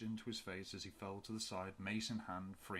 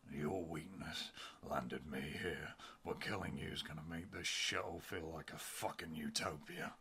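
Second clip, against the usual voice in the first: menacing thug voice; 4 semitones lower than usual. Acted. Otto threatens old pal.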